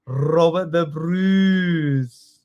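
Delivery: very slow Scottish, long rolled r; hammed-up Scottish accent. Shown as a demonstration. Teacher amusing the class with Scottish words, Scottish accent. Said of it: "Give me your best Scottish accent".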